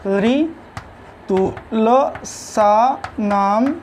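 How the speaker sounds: very slow ऋतुलसानाम, each syllable held apart, then दंताः. Shown as a demonstration. Teacher giving students the Sanskrit rule for dentals, slowly syllable by syllable. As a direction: spell out ऋतुलसानाम दंताः in long separate pieces.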